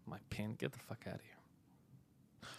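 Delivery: slow, breathy whispered scoff. Real, not acted. Whispering to himself while reading chat, scoffing. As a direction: hiss it under your breath in disbelief.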